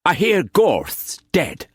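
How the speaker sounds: a hint of the eccentric painter; Quance voice. A narrator's reading.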